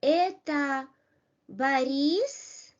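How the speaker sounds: "Это Борис" as a question, pitch climbing on Борис; rising question intonation. Shown as a demonstration. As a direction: lift the end of "Борис" upward, asking. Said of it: "pronounced with a rising intonation".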